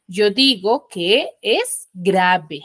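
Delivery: slow, word by word for the class; slow emphatic. Shown as a demonstration. Teacher to her class, giving the rule slowly and deliberately. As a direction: spell out the rule slowly to the pupils. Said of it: "teacher stressing the rule".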